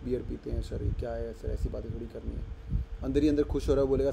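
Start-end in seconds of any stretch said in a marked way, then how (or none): 0.0-2.5 soft, lower, timid voice of a shy student; shy student impression. Shy student refusing the teacher's beer invite, timid softer voice. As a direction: play the nervous shy boy refusing. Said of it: "ये बोला".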